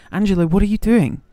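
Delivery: loud and high, bursting with delight; excited shout. Real, not acted. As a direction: shout the name out to the donor, thrilled. Greeting donor excitedly on stream.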